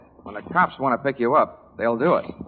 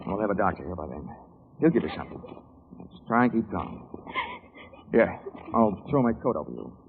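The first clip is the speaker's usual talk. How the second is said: reassuring; 2 semitones lower, 5 dB quieter than usual. Acted. Comforting wounded woman.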